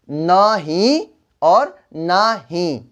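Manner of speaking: drawn out slowly, each word separate; slow careful demo. Shown as a demonstration. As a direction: spell it out slowly for the class. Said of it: "नहीं".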